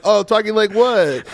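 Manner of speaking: high, slow mock-innocent question; stretched-out innocent retort; feigned innocence. Retelling his feigned-innocent reply.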